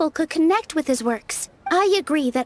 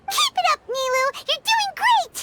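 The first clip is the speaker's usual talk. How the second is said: high, bright calls of encouragement; excited cheer; 12 semitones higher than usual. Real, not acted. Cheering Nilou on from the sidelines, excited high calls. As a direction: cheer her on at the top of your voice.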